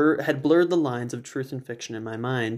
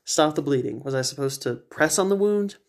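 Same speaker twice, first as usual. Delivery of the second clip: plain reading voice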